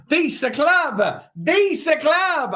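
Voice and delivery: high, drawn-out mock Italian accent; Italian caricature with swooping pitch. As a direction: ham up an Italian accent, stretching the words. Mock accent after foreign viewer name.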